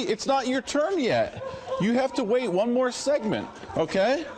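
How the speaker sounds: laughing. Real, not acted. Weatherman laughs at boy photobombing.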